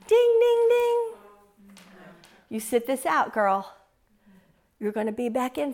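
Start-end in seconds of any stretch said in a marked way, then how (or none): 0.1-1.1 high, ringing ding ding ding; mock boxing bell.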